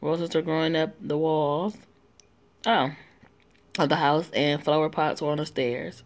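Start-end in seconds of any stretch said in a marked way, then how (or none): none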